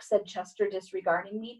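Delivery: plain reading voice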